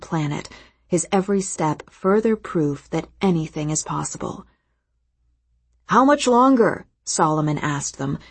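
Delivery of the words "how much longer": loud call across the yard; nearly shouting. A narrator's reading. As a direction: call out from far away. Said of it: "nearly shouting from the other side".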